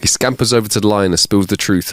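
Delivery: ordinary talk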